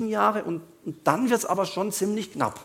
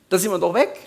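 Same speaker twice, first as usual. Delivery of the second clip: mock carefree; 6 dB louder than usual. Mocking rapture believers.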